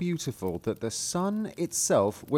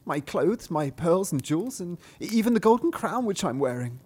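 princess voice; 7 semitones higher than usual. Acted. Princess answering the frog, high girlish squeal.

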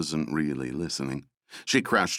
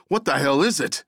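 higher, louder alarmed cry; startled shout; 7 semitones higher, 4 dB louder than usual. A narrator's reading. Russ shouting at the team as the monster appears, alarmed. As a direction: shout it out in fear. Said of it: "Russ shouted".

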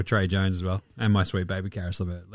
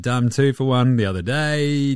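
ordinary delivery

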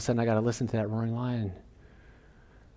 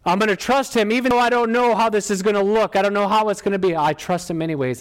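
high, forceful preacher's declaration; predicazione: fervent preaching; 8 semitones higher, 5 dB louder than usual. Acted. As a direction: proclaim it with rising conviction, high and loud.

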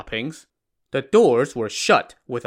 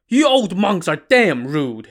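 loud, raised-pitch curse; indignant, forceful scolding; cursing; 5 semitones higher, 5 dB louder than usual. A narrator's reading. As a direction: curse them out angrily. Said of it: "he cursed".

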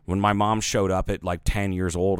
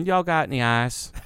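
put-on plantation Southern accent; 6 semitones higher than usual. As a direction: ask for ice like an old Southern belle, plantation Southern accent. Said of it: "the plantation Southern accent".

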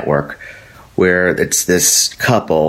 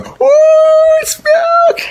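squealing shriek pitched far up, louder; Kurt Angle; 6 dB louder, much slower than usual. Retold or imitated. Wrestler spraying milk on the crowd, screeching far higher. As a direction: shriek it out in a gleeful cartoon yelp. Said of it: "Kurt Angle's going".

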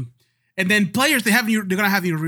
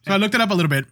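ordinary talk

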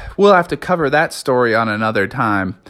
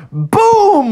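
high, loud drawn-out boom; explosive boom; 5 dB louder than usual. A narrator's reading. Beast lands a pog slam, explosive boom. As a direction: burst it out like an impact.

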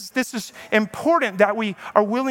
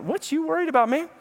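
high, easygoing shrug; casual unbothered; 6 semitones higher than usual. Retold or imitated. Daniel in the lions' den shrugs off the king's worry, high and casual. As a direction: tease the worried king lightly.

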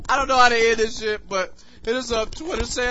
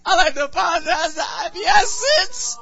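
laughing shout; 8 semitones higher than usual. Real, not acted.

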